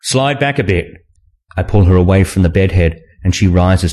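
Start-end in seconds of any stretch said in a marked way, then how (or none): 0.0-0.9 slightly raised male character line; lightly voiced instruction. A narrator's reading. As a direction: give a mild, higher-pitched instruction. Man instructs his partner.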